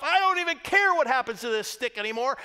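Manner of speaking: loud, high exasperated outburst; angry Moses venting; fed-up Moses. Retold or imitated. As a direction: spit it out like you're done. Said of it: "he thought to himself".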